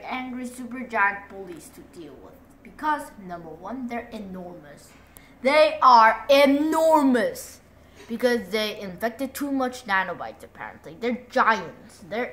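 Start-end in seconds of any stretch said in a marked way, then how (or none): 5.4-7.6 big, slow, loud declaration; emphatic loud. Shown as a demonstration. Kid stressing how huge the giants are, loud and slow.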